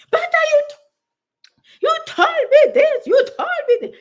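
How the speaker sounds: squeaky laughing mimic of an impatient client; mocking harsh viewers. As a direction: parody the complaining commenters. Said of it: "Some of you are very harsh".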